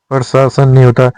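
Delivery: ordinary talk, nothing marked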